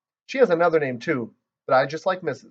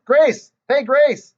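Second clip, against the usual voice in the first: much higher, louder shout; loud, high shout across the room; 11 semitones higher, 7 dB louder than usual. Acted. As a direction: call out across the classroom. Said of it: "I shouted across the room".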